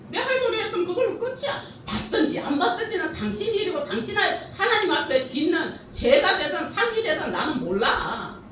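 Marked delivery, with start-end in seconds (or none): 0.1-4.9 higher and fast, stress on 할 with a rising end; defiant. Acted. Preacher declares her duty is done, brisk and high. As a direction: toss off 내 할 도리 했으면 그걸로 끝이야 quickly and high, stress on 할.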